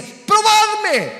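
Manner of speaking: exclamación muy aguda y fuerte; grito imperativo. Acted. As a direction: lanza el mandato divino a todo pulmón. Dios citado a gritos, luego repetido normal. (grito de predicador, alto y sostenido)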